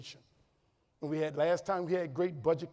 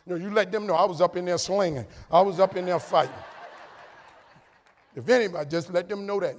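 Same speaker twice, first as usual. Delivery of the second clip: loud, punchy insistence; raised voice playing up the bravado; comic defiant boast; 7 dB louder than usual. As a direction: hammer it home like a mock hero. Comic instructions to wife, acted.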